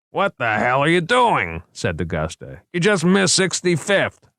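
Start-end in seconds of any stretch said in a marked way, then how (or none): 0.1-1.6 raised-pitch indignant scolding; exasperated outburst. Acted. Passenger scolds driver for missed turn. 2.7-4.3 high-pitched indignant complaint; exasperated scolding. Acted. Passenger scolds driver for missed turn.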